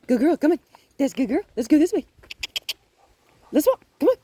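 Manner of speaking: high, loud, short encouraging bursts; excited coaxing. Real, not acted. Trainer gets the dog moving, high excited coaxing. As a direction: coax the dog along with high energy.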